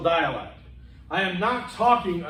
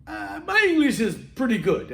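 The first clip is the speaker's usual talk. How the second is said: higher, imitated Romanian-accented English; 7 semitones higher than usual. Romanian captain checking on his English, Romanian accent. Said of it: "And he goes".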